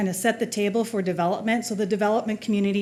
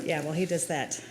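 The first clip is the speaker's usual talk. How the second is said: ordinary delivery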